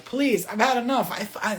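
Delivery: ordinary talk, nothing marked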